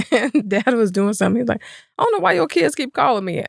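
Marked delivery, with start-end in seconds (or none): 2.0-3.4 a higher, exasperated dad voice; mock-annoyed husband, pitched up; imitating husband. Husband complaining about calling kids, mock-exasperated.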